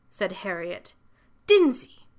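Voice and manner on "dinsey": high, loud one-word correction; firm insistence. A narrator's reading. Child correcting the librarian about her name, insistent. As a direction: put your foot down on the name.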